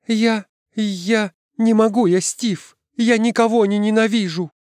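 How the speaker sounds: very high, halting protest; distraught girl. A narrator's reading. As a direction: plead that you simply can't. Девочка отчаянно отказывается.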